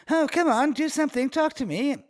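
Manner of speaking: high, pushy imitation of a British accent; mock British accent. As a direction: nag him in a put-on British accent. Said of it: "Did she turn British?".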